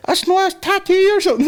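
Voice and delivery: put-on high squeaky little boy; high kid voice. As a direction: play a small kid, high.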